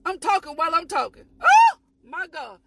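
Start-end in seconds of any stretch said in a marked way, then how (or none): none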